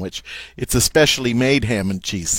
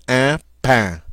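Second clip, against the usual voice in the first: ordinary talk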